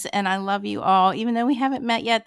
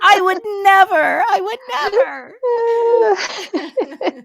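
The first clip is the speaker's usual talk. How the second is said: mock tearful shout; 12 semitones higher, 8 dB louder than usual. Emphatic loyal declaration, shout.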